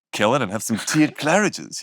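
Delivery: put-on posh British accent; half-joking attempt at posh British; American host trying out a British voice. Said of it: "I'm just trying to be British".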